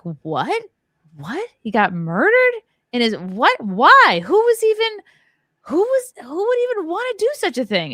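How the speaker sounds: high, pleading disbelief that keeps climbing; reliving the shock of hearing the news; stunned disbelief. Retold or imitated. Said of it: "I just was in so disbelief".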